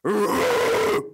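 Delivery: rough rolling metal growl; death growl. Shown as a demonstration. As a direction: growl it from the gut. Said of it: "And I can growl".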